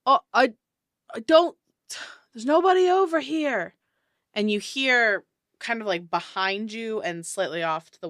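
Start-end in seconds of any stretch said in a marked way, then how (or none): 0.0-3.7 loud high shout from afar; man yelling. Acted. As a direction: call out loudly, searching. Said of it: "a man yell out".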